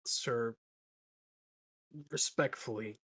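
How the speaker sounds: usual speaking voice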